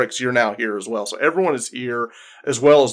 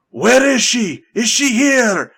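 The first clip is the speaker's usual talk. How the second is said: much higher, loud demanding shout; raider shouting; 11 semitones higher, 6 dB louder than usual. Acted. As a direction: bark it down the hall at the dwarves.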